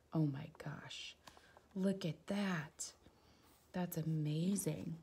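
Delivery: slow whispered amazement; awed whisper. Real, not acted. Reader marvelling at the ship, whispering. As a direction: whisper it in wonder.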